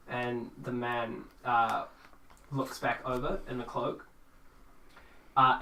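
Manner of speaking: usual speaking voice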